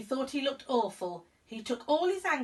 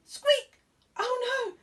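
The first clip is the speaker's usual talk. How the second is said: very high, loud mouse shriek; yelled squeak; 6 dB louder, slower than usual. Acted. Mister Mouse shrieking as the tusk snaps, very high and loud. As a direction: shriek in shock. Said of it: "Mister Mouse yelled".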